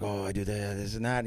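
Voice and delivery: low-pitched phone sweet talk; mock dirty talk. Teammate talking dirty on the phone, low mock sweet talk. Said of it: "he's talking dirty".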